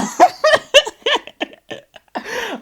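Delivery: loud, high cackling laugh; long, loud cracking up; real burst of laughter. Real, not acted. Amused at being asked for a number, loud laugh.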